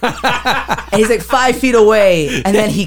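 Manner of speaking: can't hold in laughter at the misses; laughing. Real, not acted.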